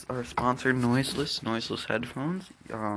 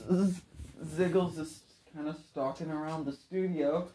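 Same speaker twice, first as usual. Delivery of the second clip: usual speaking voice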